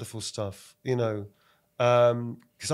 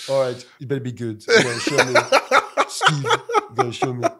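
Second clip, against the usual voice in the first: laughing French director impression; 7 dB louder than usual. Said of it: "And then he'll go".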